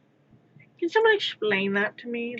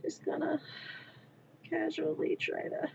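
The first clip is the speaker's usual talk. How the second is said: softer, fading whisper; whispered self-talk; 6 semitones higher, 10 dB quieter than usual. Real, not acted. Talking herself through a frustrating repair, whispered. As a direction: murmur it to steady yourself. Holding back tears over repair.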